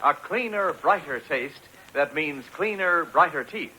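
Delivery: ordinary talk, nothing marked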